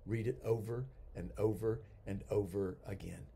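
soft, low, even repetition; hushed repetition. Real, not acted. Teacher urging students to reread the Bible, hushed repetition. Urging repeated reading, slowed.

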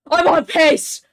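excited shout. Real, not acted. Runner reaches record pace.

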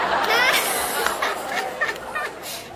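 smug laugh; diva laugh. Acted.

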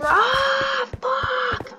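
high-pitched, drawn-out outburst; sudden exasperated scream; frustrated yell. Real, not acted. Gravel falls in the game.

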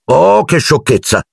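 high, drawn-out dismissal; impatient. A narrator's reading. Hermione dismissing Ron's accusation, impatient. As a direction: brush it off with exasperation. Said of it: "disse Hermione impaziente".

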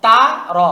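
"তা র" with open "aa", then rounded "raw"; correct vowel contrast. Shown as a demonstration. Teacher modelling the right sound, "তা র" as "taa-raw". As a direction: loudly separate open "তা" from rounded "র". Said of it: "তা হলো আ ধ্বনি".

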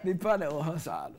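repeating the phrase through laughter; delighted laughing repeat. Real, not acted.

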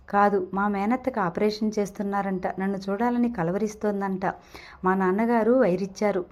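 ordinary delivery, nothing marked

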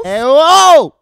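loud, high indignant outburst; mock telling-off. Scolding someone to calm down, loud mock anger. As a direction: shout them down, hammed-up.

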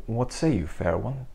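normal talking voice

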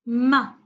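short open "ma" for "ما"; fatha syllable. Shown as a demonstration. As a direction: say "ما" as a short, firm "ma". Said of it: "ميم مع الفتحة". ("ما" بميم مفتوحة قصيرة)

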